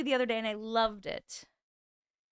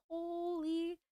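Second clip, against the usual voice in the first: usual speaking voice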